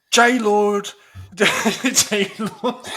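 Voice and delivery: mock outraged cry; a hammed-up flatmate crying out J Lord; half-laughing imitation of an angry flatmate; quoting the flatmate. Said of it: "his literal words were".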